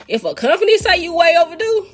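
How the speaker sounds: high-pitched laughing disbelief. Real, not acted. Laughing disbelief about raise.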